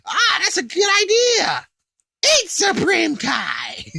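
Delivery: very high, slow gleeful shout; excited Babidi. Retold or imitated. Babidi urging Buu to eat Kai, high slow gleeful shout. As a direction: cheer it like a scheming little wizard. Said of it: "And it's like".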